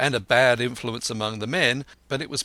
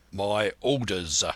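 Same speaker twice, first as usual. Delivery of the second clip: slow, clipped command; a lightly voiced stern captain; curt captain; 3 semitones lower, slower than usual. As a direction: cut him off like a stern captain. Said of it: "said the captain shortly".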